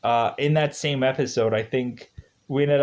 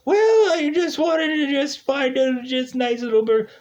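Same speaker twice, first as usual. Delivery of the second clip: goofy voice; 10 semitones higher than usual. Pretend. Voices burger guy.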